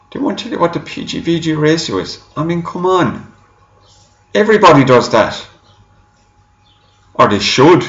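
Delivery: high-pitched exasperated protest. Real, not acted. Reviewer faulting the company for withholding ratios, exasperated. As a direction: complain in disbelief, pitch jumping up.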